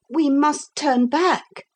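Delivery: put-on high, slow boy's voice; worried boy. A narrator's reading. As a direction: voice an uneasy child, pitched well up. Boy alarmed in dark cavern.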